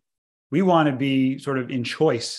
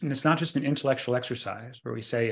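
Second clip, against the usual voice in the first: ordinary talk, nothing marked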